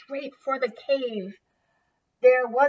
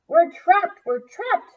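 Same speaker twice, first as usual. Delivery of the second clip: high, loud panicked cry; panicked shout; 8 semitones higher, 8 dB louder than usual. Acted. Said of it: "shouted the grunts".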